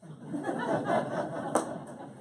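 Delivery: a breathy high burst of laughter. Real, not acted. Laughing after the woman admits the stem cells worked, high and breathy. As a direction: laugh out in delight.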